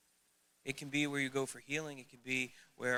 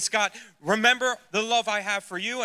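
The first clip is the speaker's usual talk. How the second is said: voice of God; 8 semitones higher, 14 dB louder than usual. Retold or imitated. God speaking in retold dialogue.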